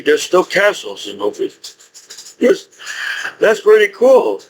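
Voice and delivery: ordinary delivery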